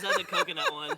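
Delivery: laughing. Real, not acted. Laughing at her own guess.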